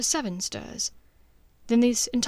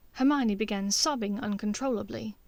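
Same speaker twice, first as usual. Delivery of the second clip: ordinary delivery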